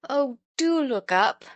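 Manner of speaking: pleading. A narrator's reading. Children pleading over fainted boy.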